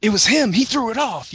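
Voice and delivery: high, loud, frantic accusation; panicked blurt pointing the finger; freaking out. Acted. Spellcaster whose spell failed blames Zale, high and frantic. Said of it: "Quoth Zin is freaking out".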